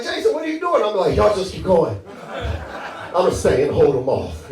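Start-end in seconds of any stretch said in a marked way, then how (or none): 0.0-0.8 much higher-pitched companions' question; companions calling him. Acted. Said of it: "'Cause they be like". 1.2-4.5 lower, slowing mock-heroic sacrifice; a hint of the noble straggler; feigned hero. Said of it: "that's not what I'm really gonna do".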